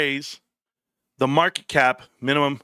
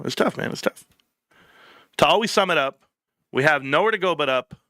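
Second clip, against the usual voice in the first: ordinary talk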